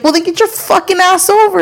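high, exasperated comeback; heated re-enacted scolding; retold road rage. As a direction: argue back at another driver, worked up.

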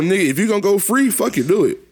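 high, fired-up rant; excited. Real, not acted. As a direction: pitch it way up, hyped. Excited rant about the homies.

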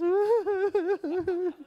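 high quavering wail; mock crying. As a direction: imitate uncontrollable wordless sobbing. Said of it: "a man following her crying".

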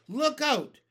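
loud, high warning shout; urgent cry. A narrator's reading. James warning his friends of flying hailstones, urgent shout. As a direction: yell a warning of incoming danger. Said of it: "cried James".

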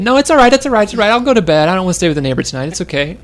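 put-on high, loud hurried little boy pleading; panicky little boy. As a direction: beg like a scared kid. Son pleading, voiced by father.

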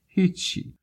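put-on small, high-pitched crying boy; high voice of a tearful boy, brushing it off. A narrator's reading. Weeping boy answers the worried stranger, tearfully. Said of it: "صدای گریهٔ پسرک بلند شد".

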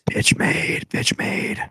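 whispered mocking chant; whispered taunt. Real, not acted. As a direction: hiss the taunt under your breath.

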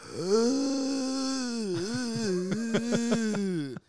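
long high raspy mummy groan; dusty mummy rasp. Shown as a demonstration. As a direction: rasp out a slow mummy moan. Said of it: "Do a mummy impression".

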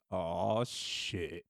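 low, soft "oh, shit" under the breath; dreading groan. Retold or imitated. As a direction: sink into resigned dread. Dad dreading the daughter's request.

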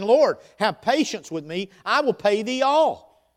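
loud, high, begging plea; pleading servant. Acted. Servant pleads with his lord.

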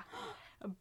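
unvoiced sharp gasp for drama; mock gasp. Host gasps before revealing the affair, hushed breath. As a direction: gasp as if shocked by gossip.

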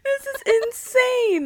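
high, slow, loud squeal; shrieking disbelief. Real, not acted. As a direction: drag it out in disbelief. Reacting to the photo.

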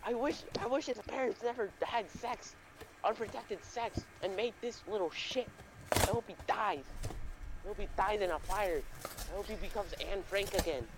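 high-pitched teacher venting, loudest at the start; hammed-up spite, easing down in pitch; hateful teacher. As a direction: rant as a teacher who can't stand the pupil. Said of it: "she just hated Raymond".

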